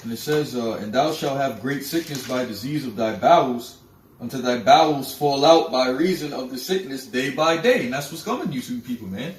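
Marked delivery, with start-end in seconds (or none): 0.7-7.8 loud, forceful preaching of the verse; scripture preaching. Acted. Warning listeners of the coming plague, preaching loudly. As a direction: thunder the warning from the pulpit.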